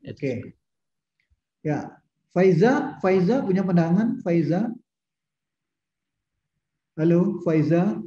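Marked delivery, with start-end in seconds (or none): 2.3-8.0 drawn-out call to get attention. Real, not acted. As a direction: call the name slowly and loudly. Teacher calling a silent student.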